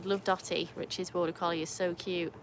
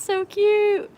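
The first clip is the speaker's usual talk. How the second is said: high, loud, drawn-out delight; excited gushing; 13 semitones higher, 9 dB louder than usual. Real, not acted. Streamer gushes over the little chat crew, high drawn-out delight. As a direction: gush slowly, pitched way up.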